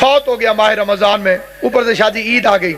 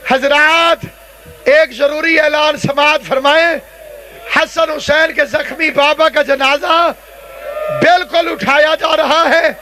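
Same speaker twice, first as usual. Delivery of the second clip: solemn funeral proclamation; 4 semitones higher than usual. Real, not acted. Preacher announcing Ali's funeral procession to mourners, solemn impassioned proclamation.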